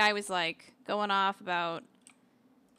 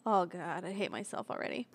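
normal talking voice

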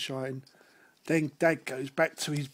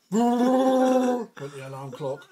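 alarm ring; 6 semitones higher, 9 dB louder, slower than usual. Acted. Alarm clock imitated loud.

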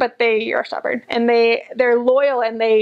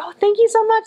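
much higher, bubbly thank you; gushing thanks; 11 semitones higher, 3 dB louder, faster than usual. Retold or imitated. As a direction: gush it up high like an eager guest. Quoting herself thanking locals.

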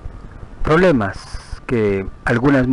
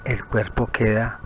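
guided meditation; 6 dB quieter than usual. Acted. Guide leading a listener to picture the body from outside, soothing meditation. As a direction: lead them gently through the visualization, unhurried.

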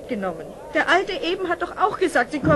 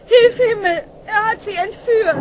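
crying out; 4 semitones higher, 8 dB louder than usual. Acted. Mother discovers children abducted.

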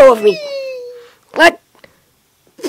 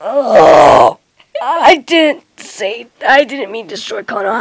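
ordinary talk, nothing marked

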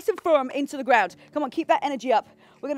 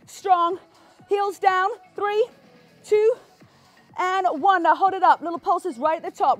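high energetic workout cues; aerobics instructor; 5 semitones higher, 5 dB louder than usual. Acted. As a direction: pump up the class. Instructor counting reps with energy.

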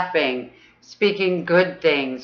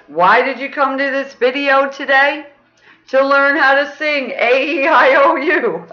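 much higher bright sing-song; playful teacher calling out, higher; 9 semitones higher than usual. Real, not acted. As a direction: sing out the question with glee.

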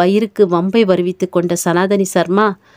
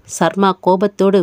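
plain reading voice

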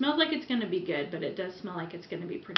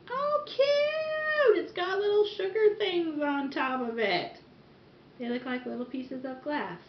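delighted; 9 semitones higher, 6 dB louder, slower than usual. Real, not acted. Delighted at sugar sprinkles.

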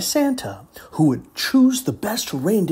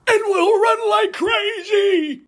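much higher, loud hearty shout; shouting prospector; 3 dB louder than usual. Acted. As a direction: bellow like a rough prospector. Prospector urges escape.

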